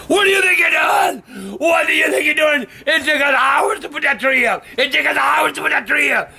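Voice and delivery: put-on high, yelling scold at a child; retold angry yelling. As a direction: shout it at the kid who wrecked the tree. Scolding child who broke tree.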